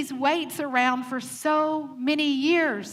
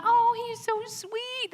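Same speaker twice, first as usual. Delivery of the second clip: high, slow, syrupy gush; mock gushing; 8 semitones higher, slower than usual. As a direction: imitate a cooing churchgoer. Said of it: "you're like".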